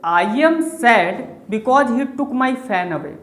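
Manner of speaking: slow, deliberate reading of the example sentence. Shown as a demonstration. Teacher dictating the pun sentence to students, slow and deliberate. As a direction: read it out word by word for the class. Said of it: "अब इस फैन word को ध्यान से देखिए".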